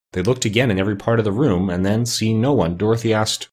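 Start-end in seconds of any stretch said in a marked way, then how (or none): none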